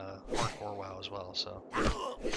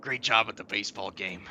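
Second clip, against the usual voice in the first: a louder put-on cousin greeting; 4 dB louder than usual. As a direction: call it out like a cartoon cousin. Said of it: "my cousin, he's".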